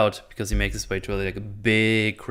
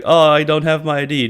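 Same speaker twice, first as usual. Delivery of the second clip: lightly acted plea, louder and higher; mock pleading; 5 semitones higher, 7 dB louder than usual. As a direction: play the man without his ID, a bit louder. Character pleading, voiced.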